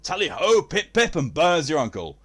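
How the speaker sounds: high, loud hammed-up English toff; exaggerated posh hurrah; jolly posh caricature. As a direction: call out the catchphrases like a cartoon aristocrat.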